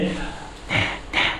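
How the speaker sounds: secretive whisper right into someone's ear; hushed whisper, as if hiding from persecutors. Shown as a demonstration. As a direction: breathe it like a secret. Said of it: "ってこう言ってる".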